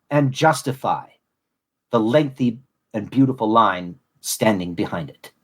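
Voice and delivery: measured, solemn reading of a prepared tribute; heartfelt closing address read from a script; formal speech. Acted. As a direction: deliver it like a dedication at a ceremony. Reads his formal closing speech.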